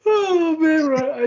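high, loud, laughing reaction; laughing exclamation. Real, not acted. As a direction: crack up while agreeing. Laughing reaction to mask rant.

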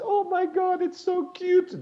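put-on high, slow, gushing girl voice; swooning girl. Girls hearing his French accent, gushing high and slow.

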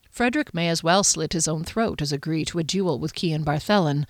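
ordinary delivery

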